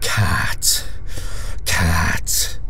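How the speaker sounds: cold toneless whisper; cruel flat whisper. A narrator's reading. Creature repeating its word to the soldier, flat whisper. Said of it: "with a voice cruel and flat".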